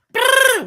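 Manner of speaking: high loud rolled brrr; excited brrr. Acted. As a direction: trill it out like a hype noise. Hyping his comeback pick.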